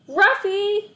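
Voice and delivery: high, loud call; boy calling his lost dog; cried out. A narrator's reading. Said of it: "I cried".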